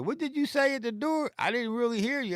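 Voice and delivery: high, put-on voice for the neighbor; lightly mock his innocent question; imitating neighbor. Neighbor asks what was said, high mock voice. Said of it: "So he was like".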